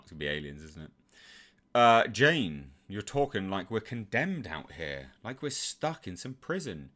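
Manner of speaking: usual speaking voice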